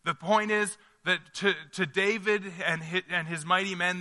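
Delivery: ordinary talk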